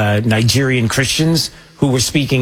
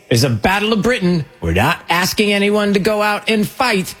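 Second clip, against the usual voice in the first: high, light mock of the rally speaker; imitating the speaker; 8 semitones higher than usual. Host quoting Tommy Robinson's rally line, lightly mocking. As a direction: parrot his words with a slight mocking lift. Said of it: "He said".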